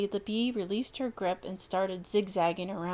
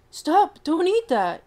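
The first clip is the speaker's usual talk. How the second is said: much higher and louder shout; yelled; 9 semitones higher, 11 dB louder, slower than usual. Acted. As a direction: yell a warning at a friend. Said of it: "Kobe yelled at Paris".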